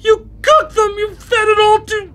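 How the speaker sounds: high, crying out in horror; horrified crying. Acted. Brother accusing his sister of murder, horrified crying. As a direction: choke on the terrible truth. Brother discovers cannibal crimes.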